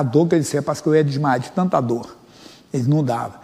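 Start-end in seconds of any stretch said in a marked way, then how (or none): none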